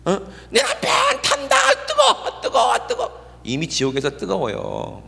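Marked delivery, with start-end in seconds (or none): 0.5-3.4 high, slow hammed-up crying shout; mock the widow's frantic wail. Imitating the widow crying over her burning husband, high mock wail. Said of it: "하고 막 울고 난리예요".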